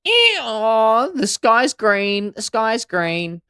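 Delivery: loud, high, drawn-out taunting bray; high, slow, obnoxious donkey shout. Acted. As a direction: needle them like a dickhead donkey, stretching the words. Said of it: "acts like a fucking dickhead".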